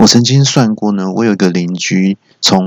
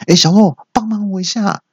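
calling from balcony; 12 semitones higher than usual. Acted. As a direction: act out calling down for a favour. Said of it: "阳台叫我说".

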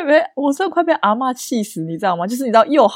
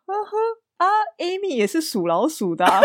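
awkward laugh-off; 8 semitones higher than usual. Retold or imitated. Aunt's awkward reply quoted.

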